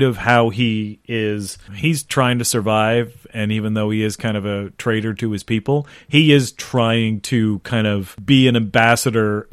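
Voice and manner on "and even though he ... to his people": ordinary delivery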